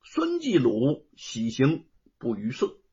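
usual speaking voice

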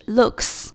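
"looks" closing on k plus hissed s; voiceless S ending. Shown as a demonstration. Teacher adding S to look, "looks" ending in voiceless s. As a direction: finish "looks" with a crisp unvoiced ks. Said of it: "那加的S发音就是S".

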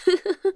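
soft, high little chuckle; short laugh. Acted. Tired investigator pleased with the findings, soft chuckle. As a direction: let out a brief, quiet giggle. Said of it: "还能笑出来".

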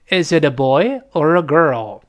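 put-on "boy" rising, "girl" falling; rise then fall. Shown as a demonstration. Teacher leading students' repetition, up on "boy", down on "girl". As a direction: raise "boy" and bring "girl" down. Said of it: "boy上去，girl降下来".

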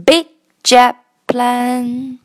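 slow Big jet plane, g and t held silent; slow linked demo. Shown as a demonstration. Teacher models the phrase slowly for students. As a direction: leave a silent beat for g and t. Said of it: "看到没有".

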